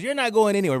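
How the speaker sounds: mild mock yell, high; loud shout. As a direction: shout it at him across the room. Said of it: "Really loud in the restaurant".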